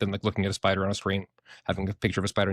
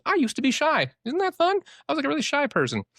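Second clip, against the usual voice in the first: playful excitement. Real, not acted. Playful aside about being shy.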